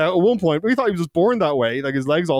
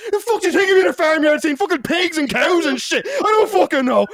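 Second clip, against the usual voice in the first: high-pitched indignant outburst; mock exasperated rant; 13 semitones higher, 3 dB louder than usual. Suspect to detective about engraving, mock furious exasperation. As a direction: imitate a suspect blowing up at a silly question.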